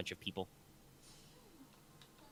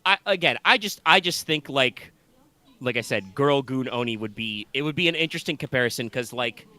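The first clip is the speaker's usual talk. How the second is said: ordinary delivery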